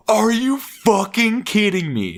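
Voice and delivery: high, drawn-out indignant fit; incredulous mock tantrum, stretched out; mock outrage. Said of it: "[name] lost his shit".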